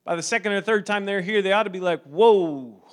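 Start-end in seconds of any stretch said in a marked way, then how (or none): 2.0-2.8 loud, drawn-out astonished whoa; stunned reaction. Acted. Newcomer reacting to a service, stunned. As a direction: let it burst out, startled.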